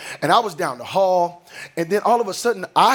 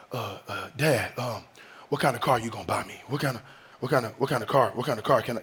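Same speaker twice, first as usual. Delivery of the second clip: put-on much lower, softer, quick mumbling teen voice; imitating teenage son; 8 semitones lower, 6 dB quieter, faster than usual. Dad mimicking his son asking daily what car he'll get. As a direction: mumble it fast like a pestering fifteen-year-old.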